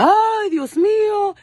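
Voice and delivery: high, slow, drawn-out Spanish exclamation; Ay Dios mío stretched high and slow; mother's dramatic cry. Retold or imitated. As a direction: wail it like an overwhelmed mom. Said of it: "She went off".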